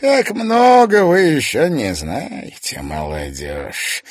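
slow theatrical villain menace; ominous. Acted. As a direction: draw it out ominously. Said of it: "зловеще произнёс он".

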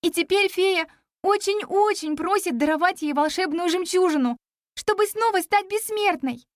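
put-on high, earnest girl's voice; pleading young heroine. Girl asking the dragon for the pearl, pleading.

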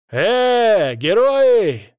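loud, high drawn-out holler; yelling. A narrator's reading. Hero shouting after the hunters' pickup, yelling. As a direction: holler after them at full voice. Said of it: "заорал я".

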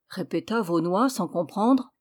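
plain reading voice

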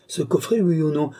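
high-pitched ultimatum; insistent demand. A narrator's reading. Countess giving the magistrate an ultimatum, insistent. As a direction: press for an answer, voice pitched well up.

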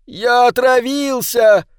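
high, drawn-out gleeful announcement; boy's playful exclamation, pitch well up; playful cry. A narrator's reading. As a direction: proclaim your pretend poisoning. Boy gleefully playacts being poisoned.

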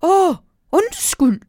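high, slow, startled owl. Acted. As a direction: apologise as a flustered owl. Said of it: "siger en stemme".

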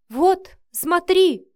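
loud, high, drawn-out demand; insistent shout. A narrator's reading. Mother producing a photo for her son, shouting insistently. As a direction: shout it as proof.

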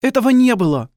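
high, loud, cold denial; wife's icy contradiction, pitched high. Acted. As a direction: shut him down coldly in a high voice. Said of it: "Её голос был холоден".

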